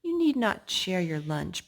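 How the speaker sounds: usual speaking voice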